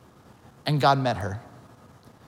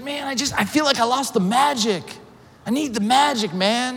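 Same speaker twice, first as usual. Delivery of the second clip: higher-pitched imitation of a desperate man; retold frustrated plea; 8 semitones higher than usual. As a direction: plead lightly as the struggling businessman. Struggling businessman pleads for lost magic.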